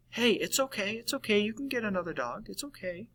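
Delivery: high, hushed coaxing voice, trailing very soft; dismissive consoling friend. Acted. Friend rejecting your emotions by consoling, dismissively soothing. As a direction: pitch it up and gently shush their sorrow. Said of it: "instead they're saying".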